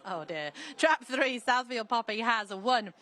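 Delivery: usual speaking voice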